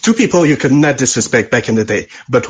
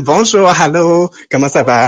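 playful French. Acted. Caller playing up French greeting.

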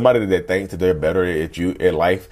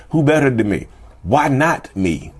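ego kicking in; 6 semitones higher, slower than usual. Acted. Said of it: "There's the ego kicking in".

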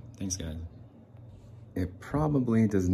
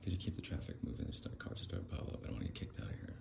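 quiet muttering; 2 semitones lower, 11 dB quieter, much faster than usual. Pretend. Beggar asking the car to move on, hushed hurried muttering. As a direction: rush it out under your breath. Beggar mumbling about piled-up traffic.

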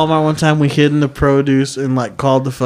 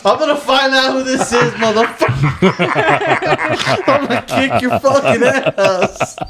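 higher mock threat broken by laughter; laughing mock threat; 8 semitones higher than usual. Retelling the pranked kid's threat, laughing through it. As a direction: threaten while barely holding back laughs.

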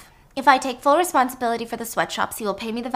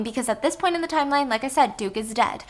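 normal talking voice

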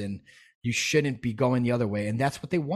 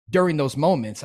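ordinary talk

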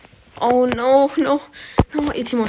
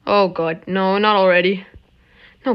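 ordinary delivery

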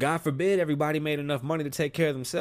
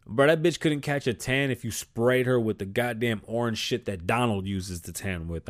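ordinary talk

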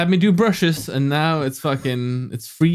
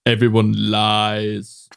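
slow dramatic; 5 semitones lower, much slower than usual. Pretend. Dramatic pronouncement.

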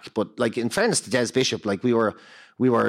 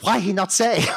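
higher-pitched imitated Chinese-accented English; mock Chinese accent; 6 semitones higher than usual. Visa clerk answering at the counter, mock Chinese accent. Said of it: "with a Chinese accent".

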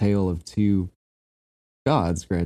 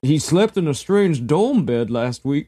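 high, hammy peasant farmer voice; ancient Greek voice; 8 semitones higher, 4 dB louder than usual. Retold or imitated. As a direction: play a rustic ancient Greek farmer, pitched way up. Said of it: "my ancient Greek voice".

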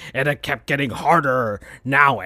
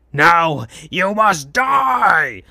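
hammed-up villain shout; menacing shout; 3 semitones higher, much slower than usual. A narrator's reading. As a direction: bellow the death sentence. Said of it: "said Vector".

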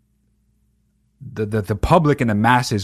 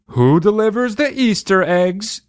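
lightly raised, cheery Sunday-school question; mock pastor quiz; 8 semitones higher than usual. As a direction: ask it like a chirpy pastor testing kids. Pastor quizzing kids on Easter.

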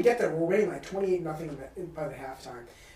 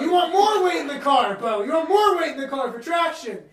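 laughing scold; 7 semitones higher, 5 dB louder than usual. Real, not acted.

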